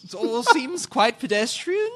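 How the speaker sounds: high, slow hammed-up British snob; mock British. Mock Brit dismissing stories of teenagers, hammed-up British accent. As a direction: draw out every word in exaggerated British hauteur. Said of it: "British curriculum".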